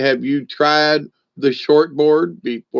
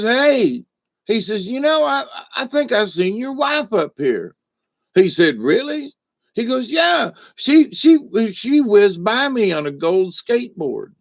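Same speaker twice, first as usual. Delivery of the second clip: pitched way up, gossipy neighbor; high neighbor voice; 9 semitones higher than usual. Retold or imitated. Neighbor telling the man about his wife, high and teasing. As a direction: squeak it out like a nosy neighbor.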